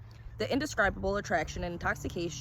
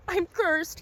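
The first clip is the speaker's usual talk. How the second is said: much higher, strained despair; anguished; 10 semitones higher, 4 dB louder than usual. A narrator's reading. Collector insists to the stranger he is cursed, anguished. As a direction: wring it out. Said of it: "he said".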